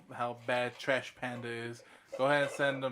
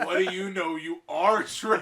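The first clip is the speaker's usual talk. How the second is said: slow, laughing mock insult; laughing taunt; 3 semitones higher, 6 dB louder, slower than usual. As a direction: tease him through the laughter. Mocking friend, laughing.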